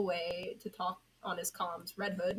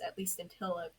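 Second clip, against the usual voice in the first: plain reading voice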